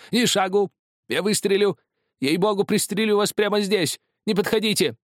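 high, loud, hysterical warning; panicked threat. A narrator's reading. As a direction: scream to keep him back. Drunk man threatens with a pistol. (истеричный угрожающий крик)

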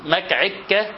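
"مكعك" with k replacing l; k for l lisp. Shown as a demonstration. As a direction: say "مكعك", k where l should be. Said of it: "اللام كافا".

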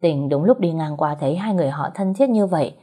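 plain reading voice

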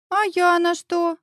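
put-on high, slow child's pout; childish offended drawl; sulky little son. A narrator's reading. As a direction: sulk like a small boy left out. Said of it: "насупился сын".

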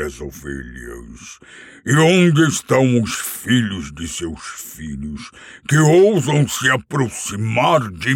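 slow, booming, menacing dragon voice; a proud dragon lording over a thief; boastful dragon. Acted. Smaug boasts to Bilbo. (dragão arrogante e ameaçador)

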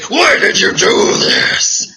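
harsh, blaming question; mean accusing. Shown as a demonstration. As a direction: snap it out like an angry accuser. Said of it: "and get all mean".

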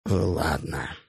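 soft, breathed-out «Ладно»; exhaled sigh. A narrator's reading. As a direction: breathe the word out wearily. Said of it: "выдыхает Лев". (на выдохе, устало)

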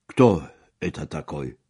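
blunt, coarse question with a loud attack; crude, abrupt demand in Russian. A narrator's reading. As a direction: ask it rudely, hitting the first word. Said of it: "spytał ordynarnie".

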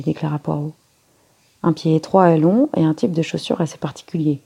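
ordinary delivery, nothing marked

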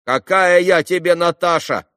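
high, loud market-woman retort; shrill yelling. A narrator's reading. Trader correcting a cheeky boy, shrill and loud. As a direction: yell back, indignant at the wrong name. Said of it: "крикливо ответила торговка".

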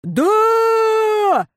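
high unified crowd yell; crowd roar. A narrator's reading. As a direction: roar back as one crowd. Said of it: "уже уверенно и слитно прокричала толпа".

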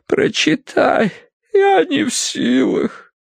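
high, slow feeble plea; faint, failing. A narrator's reading. Mother begs to have the letter read, weakly and slowly. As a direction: drag it out as if strength is gone. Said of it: "прошелестела миссис Меринг".

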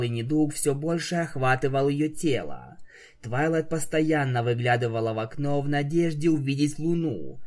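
ordinary delivery

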